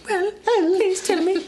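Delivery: put-on very high, slow, childlike pleading; little English boy. Playing a young English boy, pleading high. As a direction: squeak like a small orphan boy. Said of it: "thinking of him as, like, even younger".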